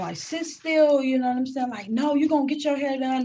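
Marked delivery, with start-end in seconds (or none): 0.2-1.0 higher, sharp mock command; stern parent. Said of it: "they be telling their children". 1.9-3.2 higher, scolding mock parent; angry parent. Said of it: "They be getting angry with the kid".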